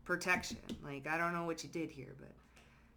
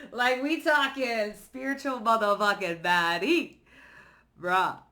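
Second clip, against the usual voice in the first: loud, high, hyped exclamation; 4 semitones higher, 9 dB louder than usual. Real, not acted. Tarot reader hyping the collective, loud and excited.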